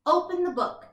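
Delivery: plain reading voice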